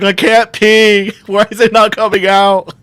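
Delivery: high, stretched, worried voice; high guy panicking about not peeing; panicked. Retold or imitated. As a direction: fret out loud, half laughing. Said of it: "running around the house like".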